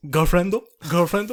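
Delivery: put-on nosy kids. Kids quizzing their teacher about his girlfriend, mock nosy. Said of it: "they're, like, looking at my girlfriend. They're like".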